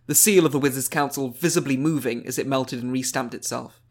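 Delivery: usual speaking voice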